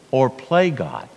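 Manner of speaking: usual speaking voice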